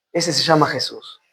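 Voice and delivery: low and soft, settling onto the name; quiet, low statement. Real, not acted. Preacher naming the one who will not fail, quiet and low. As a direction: say it softly, well down in pitch.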